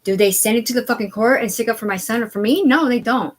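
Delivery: normal talking voice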